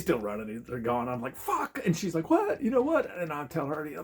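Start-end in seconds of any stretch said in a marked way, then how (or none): 1.3-1.8 high-pitched yelp of a curse; frustrated mock yell. Man seeing the thieves are gone, mock yell. 2.3-3.1 very high, loud imitation of alarm; startled questioning. Drunk girl asking what happened, mock startled squeal. Girl reacts to his shouting.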